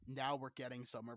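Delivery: normal talking voice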